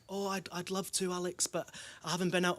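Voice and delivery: put-on Scottish accent. Elderly man regretfully answers a canvasser in a Scottish accent. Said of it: "this is my Scottish accent".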